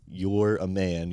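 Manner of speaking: slow, deliberate, word by word; slow, spelled out. Retold or imitated. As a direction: make him hear every word. Lecturing the friend during the retold rant.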